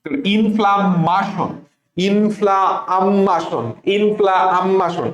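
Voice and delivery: ইনফ্লামেশন drawn out slowly with a long আম্মা; spelling pronunciation. Shown as a demonstration. Teacher models spelling pronunciation for students, slowly. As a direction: stretch ইনফ্লামেশন, leaning on the মা. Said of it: "এভাবে পড়বা".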